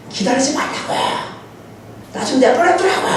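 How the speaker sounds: high-pitched mock yell, held back in volume; mock angry yelling. Angry child telling the visiting pastor to leave, imitated yelling. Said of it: "소리를 막 지르더니".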